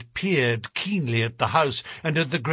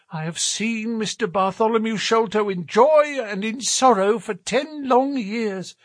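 put-on anxious old housekeeper; 6 semitones higher than usual. A narrator's reading. Housekeeper's worried account.

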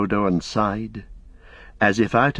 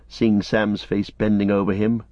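ordinary delivery, nothing marked

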